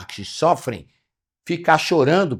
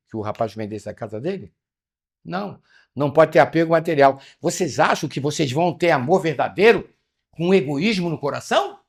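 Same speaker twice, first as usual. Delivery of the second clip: ordinary talk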